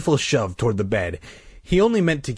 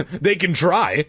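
mildly exclaimed, pitched up; exclaiming; 6 semitones higher, slower than usual. A narrator's reading. Colin hanging back from the snakes, exclaiming. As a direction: exclaim it with a touch of nerves. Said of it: "Colin exclaimed".

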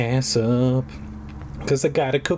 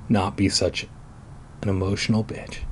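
plain reading voice

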